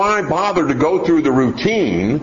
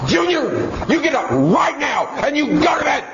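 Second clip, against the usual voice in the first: yelling mother. Acted. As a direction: scream the order at a child. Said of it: "until she's yelling and screaming".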